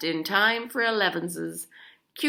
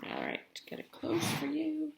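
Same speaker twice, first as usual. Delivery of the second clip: softer, higher aside; 9 semitones higher, 9 dB quieter than usual. Real, not acted. Aside to children.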